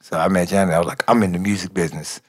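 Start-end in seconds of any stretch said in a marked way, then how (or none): 1.1-2.2 low, quick, played-down retold boast; understated introduction. Newcomer retelling how he met [name], understated introduction. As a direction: say it low and fast, almost to yourself.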